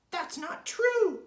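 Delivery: high, loud, slow squawk of denial; Mrs. Twit's crying protest. A narrator's reading. Wife denying she is shrinking, high loud cry. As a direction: draw out a shrill cry of protest. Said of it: "cried Missus Twit".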